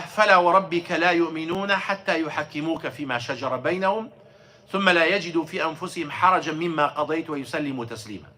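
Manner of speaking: fast, flowing chanted recitation of the verse; Quran recitation. Acted. Teacher reciting the verse to his class in Arabic, chanted. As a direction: recite the ayah in one rapid chanted breath.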